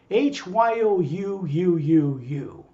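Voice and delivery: H Y O U U U U slowly, letter by letter; slow, drawn-out "aitch, why, oh, you, you, you, you"; spelled-out letters. Retold or imitated. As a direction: spell out H Y O U U U U with repeated U's. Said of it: "I'd misspell it".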